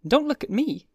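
put-on high, teasing young woman; retort. A narrator's reading. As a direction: snap back playfully. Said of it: "Daisy retorted".

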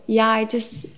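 ordinary talk, nothing marked

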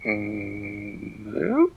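drawn-out moo sliding up into a question; hesitant moo. Acted. Dan attempting to answer the cow, hesitant questioning moo. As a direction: start slow and unsure, then swoop up high. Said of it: "And Dan said".

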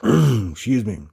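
clearing throat, choking; coughing to clear the throat. Real, not acted. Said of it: "Choking here".

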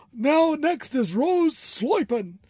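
high, drawn-out Boston accent; slow, hammed-up Northern US accent. Shown as a demonstration. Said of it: "like I'm from up north".

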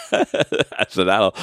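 high burst of laughter, then slow laughing words. Real, not acted. Laughing at son's boast.

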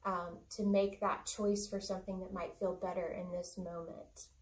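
ordinary talk

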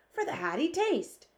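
high-pitched giant's interjection; excited cry. A narrator's reading. BFG butts in on the army head, crying out. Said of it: "cried the B F G".

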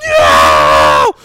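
loud mock panicked scream; screaming Quint. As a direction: imitate Quint screaming in terror. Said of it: "screaming insanely".

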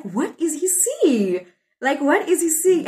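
high, drawn-out bewildered question; baffled disbelief. Retold or imitated. Wife wondering at her husband's loving look, baffled. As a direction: ask it high, truly puzzled by the admiration.

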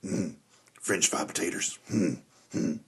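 low, soft, grunting Sling Blade voice; quiet low grunts around french fried potatoes; Carl from Sling Blade. Retold or imitated. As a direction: mumble it slow like Carl. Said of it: "he's like".

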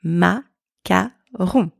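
"macaron" very slowly, split ma, ka, ron; "macaron" in three spaced French syllables; repeat-after-me model. Shown as a demonstration. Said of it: "Repeat after me".

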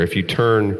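ordinary talk, nothing marked

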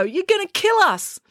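high-pitched alarmed protest; crying out. A narrator's reading. Joe to the coach poking them, crying out. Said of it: "cried Joe".

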